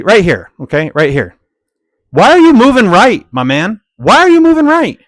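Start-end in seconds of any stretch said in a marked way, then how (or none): 2.1-5.0 high, loud, incredulous why; exasperated shout. Acted. Yelling at the driver blocking the lane, incredulous. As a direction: shout it at the screen in disbelief.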